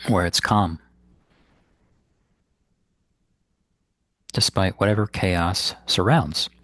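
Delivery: unhurried phrases with long, restful pauses; guided meditation. Acted. Meditation teacher guiding listeners to ground the body, in guided meditation style. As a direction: guide them gently inward, leaving space between instructions.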